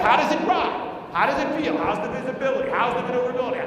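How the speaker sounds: bursting out. Retold or imitated. Husband breaking the silence to his wife, bursting out. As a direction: blurt them out loudly after holding back. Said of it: "The silence was killing me".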